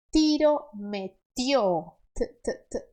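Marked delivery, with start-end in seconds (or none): 2.1-2.9 a light unvoiced t, t, t; soft T. Shown as a demonstration. Teacher demonstrates the soft Spanish T: light taps. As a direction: tap the tongue softly.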